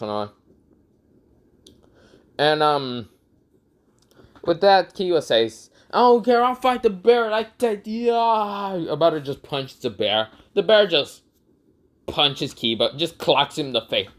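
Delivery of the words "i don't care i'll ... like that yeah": high-pitched reckless charge; battle cry. Acted. Kiba charging to fight the bear, battle cry. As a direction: build into a drawn-out attack yell.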